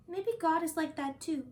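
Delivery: ordinary delivery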